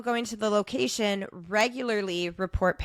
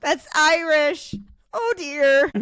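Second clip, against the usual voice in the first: comic dismay; 6 semitones higher, 6 dB louder, slower than usual. Retold or imitated. Comic retold realisation of mistake.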